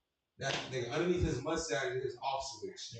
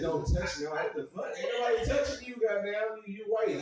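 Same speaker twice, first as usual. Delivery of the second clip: mock outrage; 7 semitones higher than usual. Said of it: "I thought you said".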